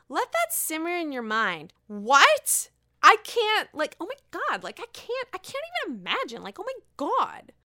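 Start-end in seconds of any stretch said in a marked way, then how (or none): 1.9-7.5 much higher, breathless shock; stunned disbelief. Real, not acted. Vlogger reacting to the gossip in high shocked disbelief. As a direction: gasp it out, can't believe it.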